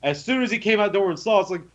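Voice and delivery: ordinary talk, nothing marked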